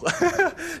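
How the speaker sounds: high-pitched burst of laughter. Real, not acted. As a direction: laugh it off at your own bit. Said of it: "laughing at his own impression".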